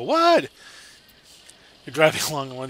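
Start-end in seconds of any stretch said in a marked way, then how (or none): 0.0-0.5 startled high "what"; sudden shocked squeak; surprised. Pretend. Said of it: "have them go".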